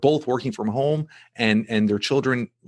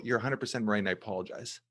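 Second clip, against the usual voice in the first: much softer, fading almost away; humbled apology; 11 dB quieter, faster than usual. Retold or imitated. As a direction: concede quietly, voice dropping off. Father retells apologising to son.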